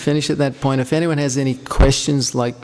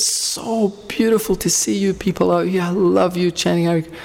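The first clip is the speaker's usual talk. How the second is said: exuberant; 6 semitones higher than usual. Retold or imitated. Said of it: "she was really exuberant".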